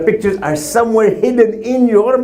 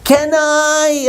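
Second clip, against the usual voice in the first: put-on higher, pleading child's plea; child begging; 7 semitones higher, much slower than usual. Kid begging mom and dad for permission, pleading. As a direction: beg like a kid asking a parent. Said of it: "it's always".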